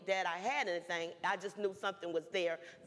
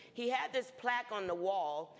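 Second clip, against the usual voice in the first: ordinary talk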